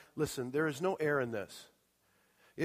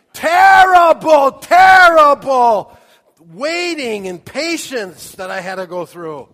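higher, much louder, slow wail on terrible; mock agony; 10 semitones higher, 18 dB louder, slower than usual. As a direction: ham up the suffering of waiting. Comic exaggerated complaint of waiting.

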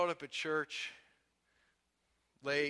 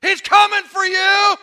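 much higher, very loud, drawn-out shout; preacher's shout; 23 dB louder than usual. Acted. Pastor calling out the lobby sitters, booming shout. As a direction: hurl it at the back of the room.

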